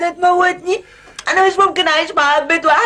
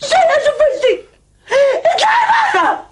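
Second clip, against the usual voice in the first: furious shout; 11 semitones higher than usual. Acted. Wife screaming at Zeinab to get out, crying fury. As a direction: scream her out of the room.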